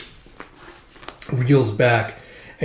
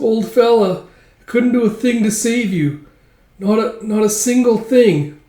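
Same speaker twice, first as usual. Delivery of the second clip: high-pitched put-on voice; lamenting captive; 9 semitones higher, 4 dB louder than usual. Little captive apologizes to an old friend, high lament. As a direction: lament in a raised little character voice.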